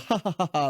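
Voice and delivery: spoken fake ha-ha, no real amusement; mirthless laugh. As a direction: imitate someone not really laughing. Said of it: "he doesn't laugh. He just goes".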